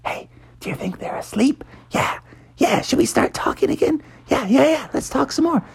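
high sneaky whisper. Acted. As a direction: whisper like plotting kids. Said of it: "starting to whisper to each other".

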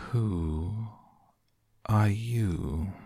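slow drowsy caterpillar; languid sleepy voice. Acted. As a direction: drawl it half asleep. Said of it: "in a languid, sleepy voice".

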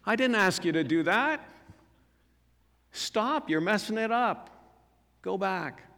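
sharp, high scolding parent. Retold or imitated. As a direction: snap at them to stop. Scolding someone at the lampstand.

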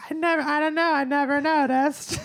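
mocking high-pitched voice; Danielle. Host imitating friend's answer about the British accent, mockingly high. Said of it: "And she goes".

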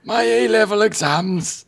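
put-on high, strained old man voice. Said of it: "give him an old man voice".

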